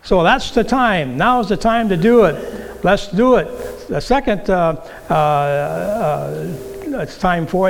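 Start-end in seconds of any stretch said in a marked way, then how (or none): 0.0-3.5 loud, punchy push to act now; rallying call. Acted. Preacher rallies the congregation to act now, loud. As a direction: fire them up to act.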